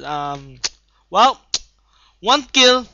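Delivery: ordinary delivery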